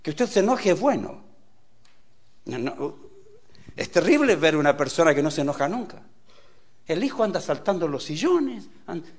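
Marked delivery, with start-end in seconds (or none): none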